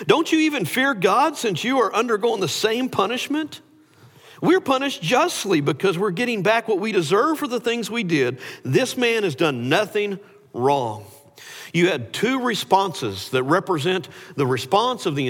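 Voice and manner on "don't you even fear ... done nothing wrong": high, earnest scolding of the other criminal; rebuking. Retold or imitated. Criminal rebuking the mocker beside him, raised stern scold. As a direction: tell him off, pitch raised. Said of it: "rebuking him".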